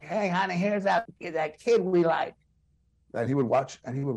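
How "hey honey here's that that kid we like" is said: put-on old husband calling to his wife, higher pitched; higher, drawn-out imitation of an old man; Bob Hope. Old comedian telling his wife the show is on, imitated call. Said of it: "he'll be like".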